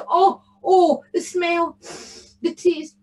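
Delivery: high, swooning delight; rapturous relish. A narrator's reading. Tam smells his cheese, rapturous. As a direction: exclaim over delicious food.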